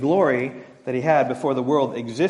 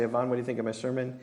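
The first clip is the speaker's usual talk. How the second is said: soft, quick hopeful ask; lightly voiced husband asking wife; fishing for praise; 4 semitones lower, 8 dB quieter, much faster than usual. Husband asking his wife about his sermon, soft and quick. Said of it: "I'll say".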